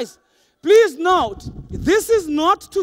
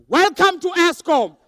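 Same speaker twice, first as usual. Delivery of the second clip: high, loud, put-upon greeting; comically irritated front-desk welcome; annoyed receptionist; 4 semitones higher, 6 dB louder than usual. Retold or imitated. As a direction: greet a visitor while resenting the interruption. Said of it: "The tone is, "Can't you see I'm on lunch?".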